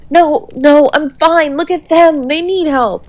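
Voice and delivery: high, loud, panicked pleading; frantic insistence. Acted. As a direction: push the medics toward them in panic. Refusing care, pointing to partner.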